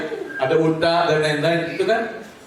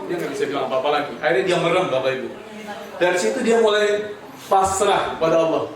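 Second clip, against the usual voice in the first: ordinary talk, nothing marked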